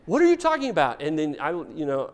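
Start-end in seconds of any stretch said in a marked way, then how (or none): 0.1-1.0 put-on high, louder whiny kid voice; a kid protesting from the back seat; child protesting. Road trip argument with kids.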